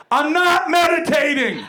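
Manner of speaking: slow, high, loud comic outburst; emphatic shouted refusal. Acted. Comedian refusing advice to meditate, shouting in exasperation. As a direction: shout it out as a defiant punchline. Climax of rant against meditating.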